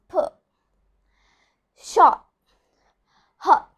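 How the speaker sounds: put, shot, hot with the final T barely heard; dropped final T. Shown as a demonstration. Teacher demonstrating an unreleased final T. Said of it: "I'm not pronouncing the letter T".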